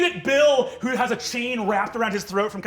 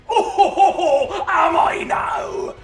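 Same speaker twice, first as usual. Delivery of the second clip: big, much higher, louder mock laugh; laughing pirate; 9 semitones higher, 8 dB louder, slower than usual. Pirate captain laughing off his arrest, loud and high. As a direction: roar it out like a delighted pirate. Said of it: "And he says".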